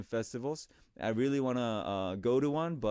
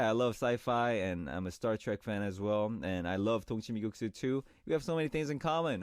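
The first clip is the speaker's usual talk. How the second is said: ordinary talk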